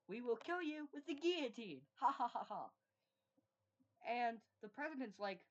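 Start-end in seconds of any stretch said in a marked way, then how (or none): 0.1-2.7 higher, louder threat ending in a cackle; villainous threat. Acted. The French revolutionaries threatening the president, gloating with a villain laugh.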